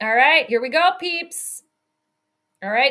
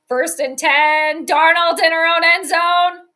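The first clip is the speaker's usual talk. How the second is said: loud, stretched, raised call; play-by-play call; 3 semitones higher, 7 dB louder than usual. Real, not acted. Streamer calling a Vikings snap in their own end zone, loud call.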